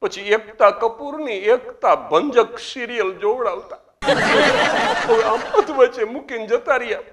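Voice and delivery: high wailing widow voice, rising; hammed-up sob story of a widow; mock weeping widow. Widow crying to the president about TV serials, mock wail. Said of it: "અને કે".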